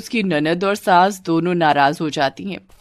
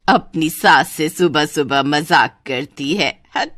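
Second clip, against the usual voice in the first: scolding mother-in-law; 3 semitones lower than usual. Acted. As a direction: brush off the joke as a saas. Mother-in-law scolds joking bride. (डाँटती सास, नीची आवाज़)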